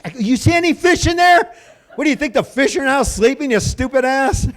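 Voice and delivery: high, loud comic outrage; high-pitched, worked-up disbelief; incredulous scolding. Retold or imitated. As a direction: berate a clueless kid. Retold scolding of the kid.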